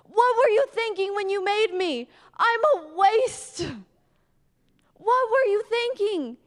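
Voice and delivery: a distraught believer crying out on his knees; desperate prayer. Retold or imitated. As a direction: beg God in despair. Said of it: "I fall on my knees and I'm like".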